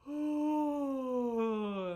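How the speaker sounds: loud yawning "Oh". Shown as a demonstration. Teacher demonstrating a jaw-opening yawn, loud. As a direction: stretch the jaw with a yawned sound. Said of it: "you can yawn while you do this".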